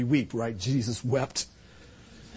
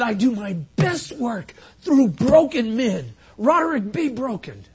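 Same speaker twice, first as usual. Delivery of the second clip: slow, raised preaching of God's word; emphatic repeat; 6 semitones higher, 5 dB louder than usual. Retold or imitated. Preacher repeats God's word to the church, emphatic. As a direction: drive each phrase home. Same words repeated more forcefully.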